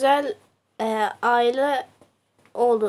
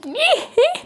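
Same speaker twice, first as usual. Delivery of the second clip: high laugh; 13 semitones higher than usual. Real, not acted. Laughs after the stabbing line.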